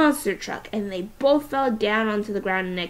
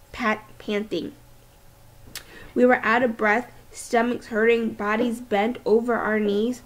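ordinary delivery

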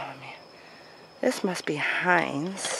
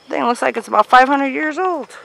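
high, loud, amazed; 8 semitones higher, 9 dB louder than usual. Real, not acted. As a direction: wow at the huge tree. Amazed at huge tree.

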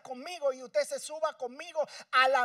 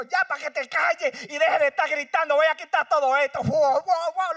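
husband fed up; 5 semitones higher, 10 dB louder than usual. Retold or imitated. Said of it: "llegó y dijo".

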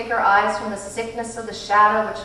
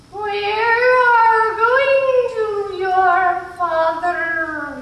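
much higher, louder, very slow echoing line; eerie otherworldly voice; 13 semitones higher, 8 dB louder, much slower than usual. A narrator's reading. Missus Witch answering Meg, slow eerie intoning. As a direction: intone it like a distant being.

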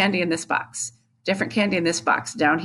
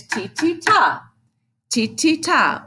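two short "ti" beats, then long "ta"; higher, quick "ti, ti" into a drawn-out "ta"; fast, fast, slow; 7 semitones higher, slower than usual. Shown as a demonstration. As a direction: chant "ti, ti, ta" with a held last beat. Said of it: "or fast, fast, slow".

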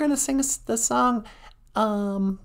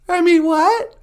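normal talking voice

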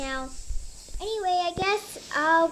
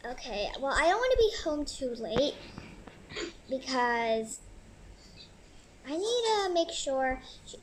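normal talking voice

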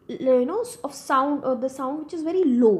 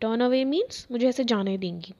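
ordinary talk, nothing marked

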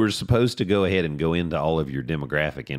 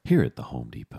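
mock commercial voice; 7 semitones lower than usual. Co-host riffing a Home Depot ad, low commercial voice. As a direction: slip into a lowkey store commercial.

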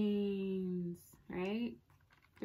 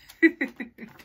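laugh; 2 semitones higher, 7 dB louder than usual. Real, not acted. Laughs after zombie demo.

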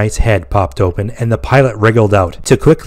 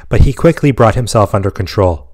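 ordinary delivery, nothing marked

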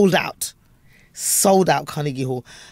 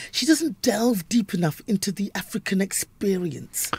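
slow, higher mock-critic voice; pompous critic; 4 semitones higher, 4 dB quieter, slower than usual. Quoting a Guardian critic's review of his show, mock pompous. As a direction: intone it like a lofty reviewer. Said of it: "That was what he wrote".

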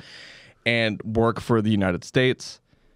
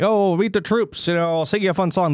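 higher-pitched mock USO performer; showbiz entertainer; 7 semitones higher than usual. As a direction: play a cheesy troop-show celebrity, pitched up. Mimics showy USO celebrity entertainers.

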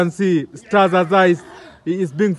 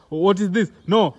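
ordinary delivery

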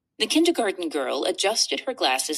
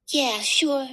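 put-on Georgia accent; 6 semitones higher than usual. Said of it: "without her Georgia accent coming through".

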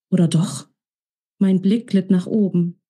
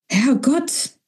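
breathless startled cry, pitched up; startled gasp; 5 semitones higher than usual. Acted. Sophia, frightened by Alex, cries out breathlessly. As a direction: she clutches her chest after a fright. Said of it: "rief sie schwer atmend".

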